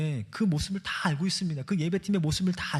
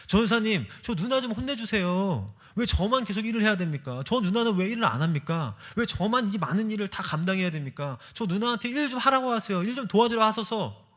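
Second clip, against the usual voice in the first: raised, higher complaining plea; complaining to pastor; 7 semitones higher than usual. Retold or imitated. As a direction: whine the grievance up high to the pastor. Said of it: "마르다와 같은 모습으로 ... 따지고 있더라고요". (전도사님께 높은 목소리로 따지는 불평)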